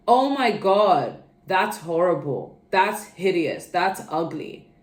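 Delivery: loud, slow, mocking gasp of disgust; mock harsh insult. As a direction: ham up a rude critic. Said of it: "Criticism isn't saying".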